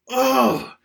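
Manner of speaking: usual speaking voice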